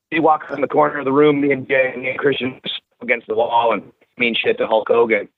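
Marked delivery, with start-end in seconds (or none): none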